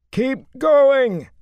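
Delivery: very loud, high frantic bellow. A narrator's reading. Matt bellowing at the peddler, frantic shout. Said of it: "Matt bellowed".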